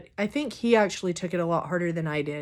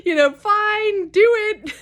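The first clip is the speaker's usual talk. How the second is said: mock reluctance; 12 semitones higher, 4 dB louder, slower than usual. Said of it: "And I'm like".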